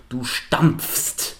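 "stampfst" slowly, every consonant sounded; careful complex cluster. Shown as a demonstration. Teacher announcing the silver-medal syllable, slow careful articulation. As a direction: draw out the pfst ending of "stampfst". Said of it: "Auch das ist super kompliziert".

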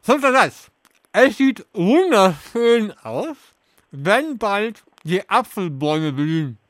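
talking through a mouthful of marshmallows; slow, stuffed-cheek speech; mouth full. Real, not acted. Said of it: "mit vollem Mund alle Sätze vor".

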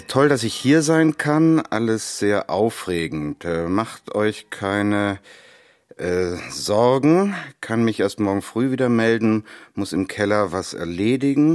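halting, drawn-out reading of a text message; slow reading aloud. Acted. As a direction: read it out word by word. Vater liest Toms SMS vor. (die SMS langsam und stockend vorlesend)

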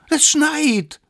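very high, loud squeal; squeaky exclamation at top pitch; excited little one. Acted. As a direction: shriek it as a sudden discovery. Smallest announces snow excitedly.

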